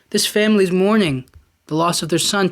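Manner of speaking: normal talking voice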